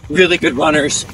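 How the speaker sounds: normal talking voice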